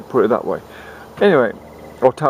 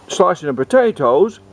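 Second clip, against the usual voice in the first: plain reading voice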